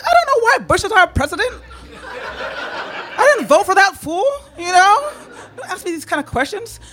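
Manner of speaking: high, wailing comic exasperation; loud, squealed mock despair; flustered protest. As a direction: protest helplessly, voice pushed way up. Flustered comeback to questions about Bush.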